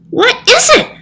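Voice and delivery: high cat voice, sulking; a pouting cat demanding to know; sulky. Acted. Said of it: "the cat sulked".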